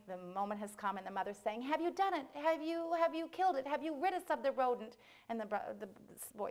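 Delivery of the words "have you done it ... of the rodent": high, insistent questioning; nagging mother. Acted. Mother checking the boy killed the mouse, high nagging.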